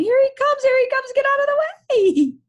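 very high, loud panicked yell. Acted. Alan and Joe fleeing uphill, yelling. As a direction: scream a warning, stretching the last word.

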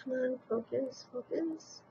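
very soft under-breath mutter; quietly urging herself to focus. Real, not acted.

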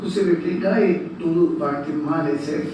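ordinary delivery

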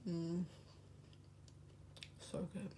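humming while chewing; speaking with food in mouth; mouth full, chewing. Real, not acted. Seen 2: Bites tart at -3, then chews with closed, full mouth throughout.

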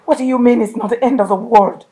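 hysterical shout. Acted. Wife screams at her husband about their daughter, hysterical. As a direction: scream your fear at him.

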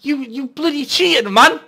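high, loud furious yell; outraged shouting. Real, not acted. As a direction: scream at the cheating bowler. Raging at bowling opponent.

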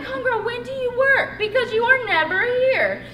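high-pitched, loud mock complaint; comic exasperation. Missing Tara again at her workplace, exasperated. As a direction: demand it in playful exasperation.

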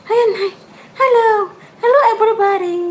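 high cutesy puppy voice greeting everyone; voicing the dog. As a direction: speak for the puppy, sweet and high.

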